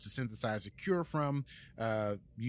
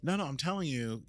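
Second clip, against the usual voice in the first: ordinary talk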